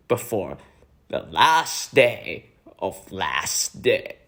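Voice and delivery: drawn-out, swooping sermon voice; stretched, pompous preaching; mocking preacher impression. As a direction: parody a cult leader's solemn pronouncement. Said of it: "ridiculing their leadership".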